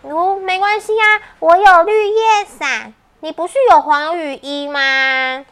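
put-on high bright child character; cheerful little girl voice.

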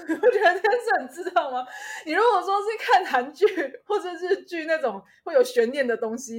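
laughing loudly while talking. Real, not acted. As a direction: laugh through the words. Laughing at brother's silly excuse.

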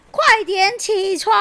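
mock shout. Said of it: "国王大叫说".

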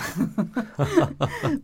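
bright amused laugh. Real, not acted. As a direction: laugh after mimicking. Host laughs at own imitation. (开心地笑出来)

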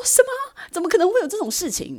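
high, loud shocked cry; shocked disbelief. Retold or imitated. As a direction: gasp it out in disbelief. A shocked by the offer.

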